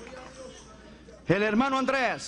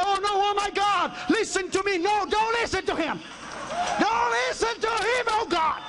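frantic pleading; 9 semitones higher than usual. Retold or imitated. Passenger and preacher plead to God.

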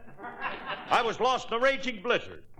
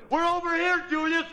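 calling out; 10 semitones higher than usual. Acted. Calling out to rescuer.

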